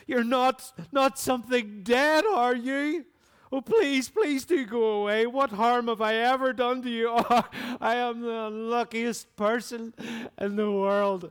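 high, loud, panicked pleading; almost screaming. Acted. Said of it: "he said almost in a scream".

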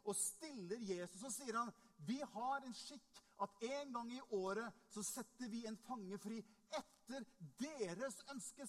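loud, driving sermon delivery; forceful preaching. Acted. Preacher retelling Pilate's choice to the congregation, forceful and loud. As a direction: preach it out with hard punched key words.

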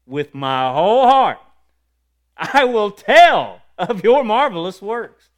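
loud, drawn-out cry of praise; fervent proclamation. Acted. Believer affirming the Lord with a psalm, fervent proclamation. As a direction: burst into a shouted declaration, stretching the words.

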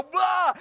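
loud, high comic shriek; imitated animal alarm. Animal seeing a car come, loud mock alarm cry. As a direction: blurt a startled animal cry. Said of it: "the animal should go".

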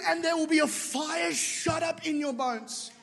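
slow, high, ringing proclamation; prophetic shout. Retold or imitated. Retelling Heidi Baker's prophecy over him as a slow high shout. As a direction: shout the prophecy slowly like fire. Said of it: "She said".

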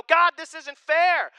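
high, loud, slow whine at God; whiny complaint. Retold or imitated. Believers complaining to God, high, loud, slow whine. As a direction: complain like a spoiled child. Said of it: "we go".

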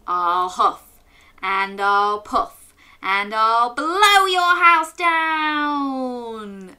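slow, swelling to a loud high blow; big bad wolf. The wolf's threat said along with the children, building loud. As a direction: puff it up and blow it down.

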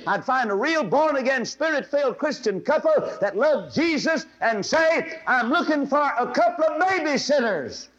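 high, loud preaching cry; full-force preaching. Acted. Preacher pleading with girls in the audience, at full force. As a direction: preach it with fire.

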